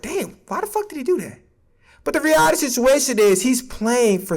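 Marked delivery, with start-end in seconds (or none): 0.0-1.4 baffled fan. Retold or imitated. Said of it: "be like".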